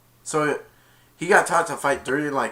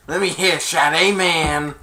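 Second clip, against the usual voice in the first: slow, louder put-on voice; imitating Dizzy; 4 semitones higher, 8 dB louder, slower than usual. Kid retelling Dizzy's line in a slow, louder put-on voice. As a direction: drag it out like a cocky kid. Quote from the movie.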